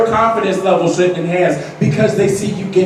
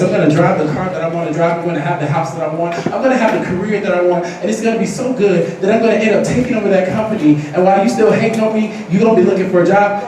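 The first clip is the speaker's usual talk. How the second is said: fast, building list of boasts; rapid-fire comeback; 2 semitones lower, faster than usual. Acted. Student tells a hater their future plans, rapid and assured. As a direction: rattle it off, gathering strength as it goes.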